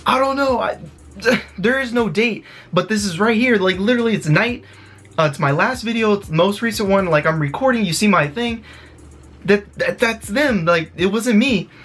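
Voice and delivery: high-pitched, frantic explaining; freaking out. Retold or imitated. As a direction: scramble to prove it to the cop. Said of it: "like, freaking out".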